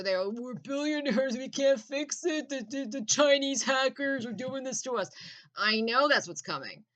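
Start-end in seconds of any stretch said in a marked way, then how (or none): 0.2-5.1 high, put-on whiny rich-guy voice; mocking billionaires. Mocking the billionaires blaming hackers, in a high whiny voice. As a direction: sneer it like a clueless billionaire making excuses. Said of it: "some fucking ridiculous shit where".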